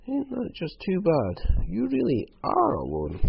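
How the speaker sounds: high, slow, drawn-out false pity; mock sympathy. Croc learning that Hal is alone, mock-sympathetic. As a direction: feign pity with pitch way up, lingering on it.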